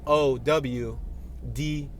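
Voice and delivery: slow, letter by letter; spelling out. Shown as a demonstration. Driver spelling the billboard word out slowly. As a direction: spell each letter deliberately. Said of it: "they wrote that like".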